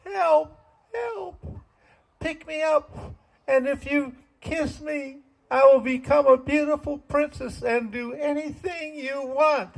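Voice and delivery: very high, small frog voice; tiny frog voice. Retold or imitated. Frog on a log begs the men, very high squeaky voice. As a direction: squeak it like a little frog promising. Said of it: "it was going".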